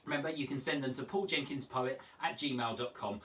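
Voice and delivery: ordinary talk, nothing marked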